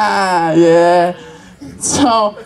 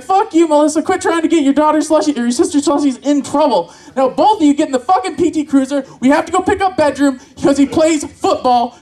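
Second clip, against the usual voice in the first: higher-pitched, exasperated mock telling-off; scolding parent; 4 semitones higher than usual. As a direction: order the kids about in comic fury. Parent scolding imaginary children.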